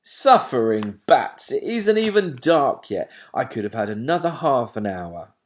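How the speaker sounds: loud scolding from a sleepy dad; loud fed-up father owl; cross father owl. A narrator's reading. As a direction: tell off the pesky owlet loudly.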